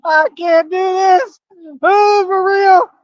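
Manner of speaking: high, slow, drawn-out wail of amusement; overcome with hilarity. Real, not acted. Unable to carry on for laughing, overcome with hilarity. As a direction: stretch each word, helplessly amused.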